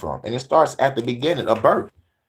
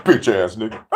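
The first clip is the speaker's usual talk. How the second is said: 1 taunting Lucifer; 2 semitones lower than usual. Acted. Lucifer taunting angels in heaven.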